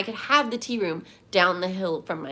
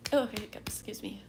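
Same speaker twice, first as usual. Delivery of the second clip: breathy whispered apology between hiccups; 6 dB quieter than usual. Real, not acted. Whispering an excuse to chat after hiccuping. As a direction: hush it out, caught by a hiccup.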